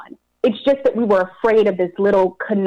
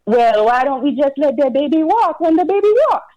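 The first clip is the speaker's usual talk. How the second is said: husband's reply; 5 semitones higher than usual. Retold or imitated. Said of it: "Now more than slightly irritated".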